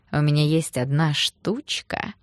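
slow, teasing innuendo; suggestive come-on. Acted. Thug propositions the girl, slow and suggestive. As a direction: draw it out suggestively.

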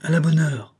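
high, glad exclamation; lightly acted joyful outburst; cry of joy. A narrator's reading. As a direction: exclaim with boyish relief. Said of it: "L'enfant jeta un cri de joie".

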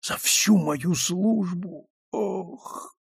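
slow words breaking into a cry; dying groan. Acted. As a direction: groan through the pain.

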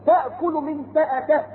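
Quran recitation. Acted. Preacher reciting to the congregation, slow chant. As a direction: draw the words out in chant.

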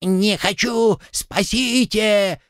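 high, drawn-out panicked wail; вопль о помощи. A narrator's reading. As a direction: wail for rescue high and stretched, without much volume. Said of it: "вопил". (пронзительный протяжный вопль)

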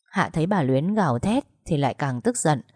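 usual speaking voice

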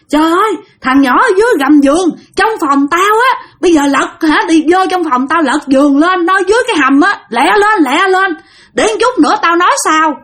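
high, urgent shouting orders; yelling urgently. Retold or imitated. Revived old man yelling at family to find the boy, high and urgent. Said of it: "ổng mới la làng lên".

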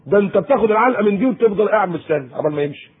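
usual speaking voice

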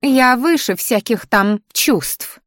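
high, slow lofty plant voice; the flower haughtily dismissing feelings, slow and high; haughty flower. Acted. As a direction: declare it with grand disdain.

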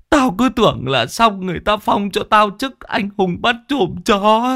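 high, drawn-out blubbering lament; hammed-up weepy wail; tearful whimpering. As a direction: whine to your friend, on the verge of bawling. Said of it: "mếu máo".

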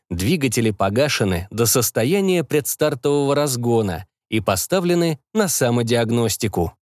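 plain reading voice

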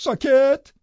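sharp command. A narrator's reading. Catherine silences Hareton.

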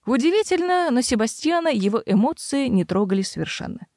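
ordinary delivery

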